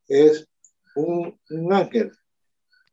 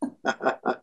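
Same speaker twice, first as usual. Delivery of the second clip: soft laugh; 10 dB quieter than usual. Real, not acted. Laughs at the joke.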